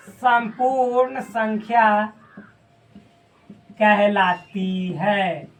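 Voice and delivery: drawn-out words with long gaps, as if writing; slow dictation. Real, not acted. Teacher writing out the definition for the class, slow dictation. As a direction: dictate it slowly, piece by piece.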